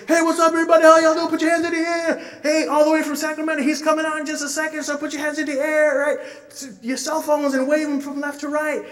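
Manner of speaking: loud, high, fast hype man pumping the crowd. Retold or imitated. Hype man firing up a rap concert crowd, loud and high. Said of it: "the hype man will come out and say".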